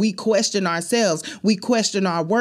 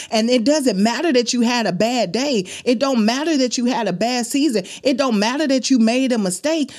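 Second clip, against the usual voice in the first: rising fervor; 6 semitones higher than usual. Real, not acted.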